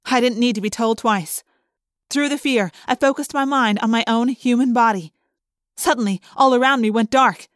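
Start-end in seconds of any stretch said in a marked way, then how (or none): none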